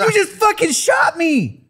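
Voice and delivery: high-pitched yell; hammed-up outraged shout; mock shout. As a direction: screech the accusation. Acting Rasputin shot.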